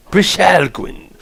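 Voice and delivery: "Brishalgwin" high, slow and grand; announcer delivery. Acted. Streamer announces "Brishalgwin" after practising it, grand announcer delivery. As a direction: proclaim "Brishalgwin" as an announcer would. Announces the word once worked out.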